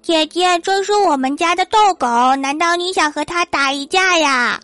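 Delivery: put-on high, childlike teasing voice; little boy voice. Boy turning back to tease the scared big sister, high childish voice. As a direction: play a cheeky small boy talking up. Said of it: "回头对我说".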